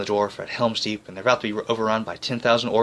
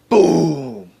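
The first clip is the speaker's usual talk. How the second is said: loud "Boom!" explosion sound, higher; mock explosion; 6 semitones higher, 6 dB louder than usual. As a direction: shout it like a blast going off. Sound effect after forts blown up.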